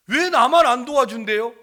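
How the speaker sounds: loud, high complaint; retold child's protest. Sick child protesting to the uncle, loud and aggrieved. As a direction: imitate an aggrieved child crying out.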